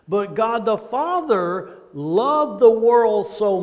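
slow, loud and deliberate; slow emphatic preaching. Real, not acted. Preacher to congregation, slow and emphatic. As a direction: lean on each word for the flock.

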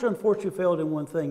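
normal talking voice

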